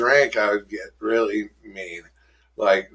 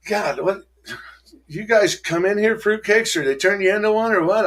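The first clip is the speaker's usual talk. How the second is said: higher, scornful disbelief; mock disgust; 10 semitones higher than usual. Newcomer sneers at hugging members, higher and mocking. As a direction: sneer at the huggers from a higher pitch. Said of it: "And I'm like".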